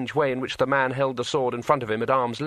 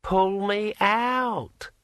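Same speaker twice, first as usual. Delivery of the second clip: very high, drawn-out ringing voice; like a wet finger on a wine glass; ringing metallic sword voice; 9 semitones higher, much slower than usual. A narrator's reading. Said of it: "in a voice like the sound of a finger dragged".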